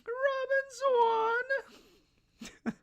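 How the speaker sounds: very high, loud, slow swooning calls; lovestruck Sanji cry. Retold or imitated. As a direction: wail the name like a smitten fool. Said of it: "Sonny goes".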